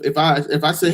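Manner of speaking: normal talking voice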